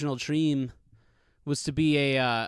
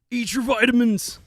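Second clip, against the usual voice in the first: higher put-on wrestler voice, laughing into it; Hulk Hogan impression; 7 semitones higher, 5 dB louder than usual. Vlogger showing off his better Hulk Hogan impression, higher hammed-up voice. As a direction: ham up a Hulk Hogan catchphrase voice. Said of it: "I do a much better, like, Hulk Hogan".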